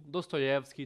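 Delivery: usual speaking voice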